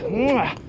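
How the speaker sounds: blown "mwah" kiss, pitched high; a big appreciative smacked kiss; exaggerated kiss. Real, not acted. Runner admiring the Camel Estuary, appreciative kiss sound.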